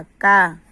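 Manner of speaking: "का" said as one loud blended syllable, क plus आ; joined syllable demo. Shown as a demonstration. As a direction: sound out "का" loudly as the joined result. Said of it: "क plus आ". ("का" को ज़ोर से जोड़कर बोलना)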